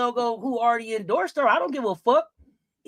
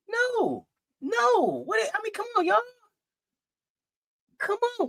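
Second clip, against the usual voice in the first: incredulous; 11 semitones higher than usual. Real, not acted. Reading chat votes, incredulous.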